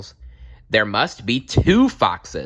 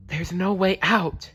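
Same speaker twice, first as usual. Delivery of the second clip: desperate; 10 semitones higher, 5 dB louder than usual. A narrator's reading. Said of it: "she thought desperately".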